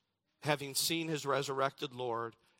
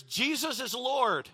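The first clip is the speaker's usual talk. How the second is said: much higher and louder preaching proclamation; bold declaration; 8 semitones higher, 6 dB louder than usual. Acted. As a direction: proclaim it boldly to the crowd. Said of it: "He declares".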